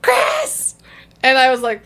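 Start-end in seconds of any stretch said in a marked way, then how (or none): 0.0-0.8 high, carrying call from next door; calling out a name. Retold or imitated. And I heard.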